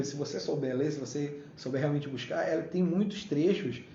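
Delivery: ordinary delivery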